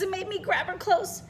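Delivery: plain reading voice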